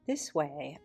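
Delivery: usual speaking voice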